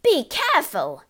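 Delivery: high, loud warning shriek. A narrator's reading. Mother warning husband over spilt powder, shrieking. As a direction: shriek in alarm. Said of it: "shrieked the mother".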